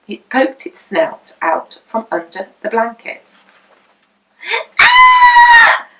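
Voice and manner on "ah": shrill shock scream, much higher and louder. A narrator's reading. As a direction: scream as if the baby turned into a pig. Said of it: "screamed Missus Potter".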